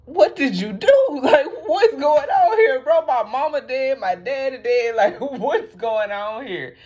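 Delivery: high, laughing mock outrage; upset Sasuke. Sasuke confronting his brother, hammed-up laughing outrage. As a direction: play a stunned, half-laughing Sasuke. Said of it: "he's like".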